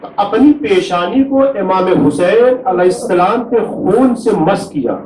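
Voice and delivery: keen the tragedy aloud to draw the mourners to tears; masaib lament. Acted. Preacher to mourners at a majlis, high wailing lament.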